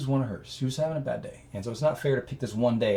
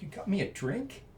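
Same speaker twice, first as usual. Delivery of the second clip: touched surprise; 8 semitones higher, 6 dB quieter than usual. Retold or imitated. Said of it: "she was like".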